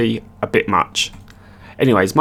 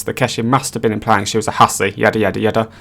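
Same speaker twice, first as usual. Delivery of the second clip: ordinary delivery, nothing marked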